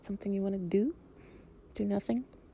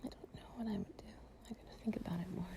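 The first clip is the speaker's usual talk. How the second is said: soft whisper; 18 dB quieter than usual. Acted. Lying close to a partner, drifting off, in a soft whisper.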